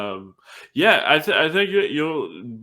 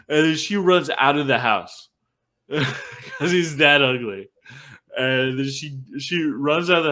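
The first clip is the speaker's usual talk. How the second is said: higher, louder, breaking into real laughter; laughing; 6 semitones higher, 5 dB louder than usual. Real, not acted. Host cracking up over how ugly he is, laughing. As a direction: tell it while cracking up.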